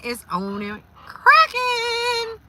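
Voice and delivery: sings it out high and stretched; hyped up. Real, not acted. Telling viewers she's moving, hyped and stretched. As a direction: stretch it out, celebrating.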